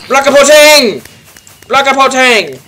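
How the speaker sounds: high, loud nonsense battle yell; silly war cry. Acted. Gamer bellows a nonsense battle cry at enemies. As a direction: shout it like a crazed warrior.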